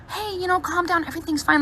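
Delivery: a soft, reassuring nurse; calming. Retold or imitated. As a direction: hush her gently like a calm nurse. Staff calming her.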